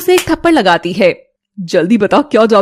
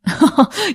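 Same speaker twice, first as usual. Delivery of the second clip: short wicked chuckle at her pain; cruel laugh; 5 semitones higher, 3 dB quieter than usual. Acted. Stepmother laughing cruelly at the girl's pain. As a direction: gloat with a nasty little laugh.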